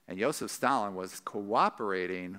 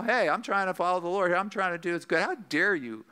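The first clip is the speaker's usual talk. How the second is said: higher, protesting, acted-out defensiveness; mildly indignant self-defence; 7 semitones higher, 3 dB louder than usual. Believer defending himself against an accusation, mildly indignant. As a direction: plead your good intentions with a touch of affront. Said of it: "that's the tendency in a situation".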